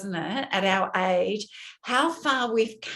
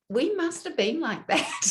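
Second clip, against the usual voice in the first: wistful laugh; 10 semitones higher than usual. Real, not acted. Wistful thought, then laugh.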